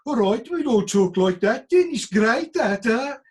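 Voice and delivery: loud, high mock dimwit voice; stupid guy. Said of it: "They want a stupid guy in a film".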